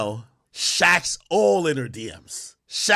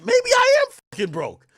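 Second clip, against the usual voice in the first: much higher, half-shouted panicked thought; mock panic. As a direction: squeal it out like a sudden shock. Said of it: "you start thinking, like".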